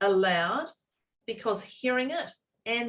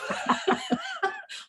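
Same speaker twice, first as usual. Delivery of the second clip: a sudden high burst of laughter. Real, not acted. Host laughing at her co-host's gentle suggestion, high laugh.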